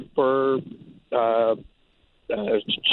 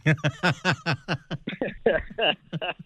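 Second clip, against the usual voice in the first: long, higher-pitched chuckling laugh; hearty laugh; 6 semitones higher, 4 dB quieter than usual. Real, not acted. Producer cracks up at the host's comedian comparison, laughing. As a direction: burst into a long run of laughter.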